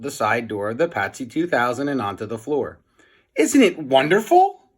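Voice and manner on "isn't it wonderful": high, loud and thrilled; exclaiming. A narrator's reading. Melvin proudly shows his living mouse, exclaiming high and loud. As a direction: burst out with pride. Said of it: "exclaimed Melvin".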